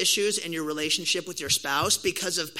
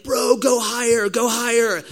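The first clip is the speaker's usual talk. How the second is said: loud, high cheering yell; excited shout; 5 semitones higher, 7 dB louder than usual. Retold or imitated. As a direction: egg him on at full volume. Calling to brother on trampoline.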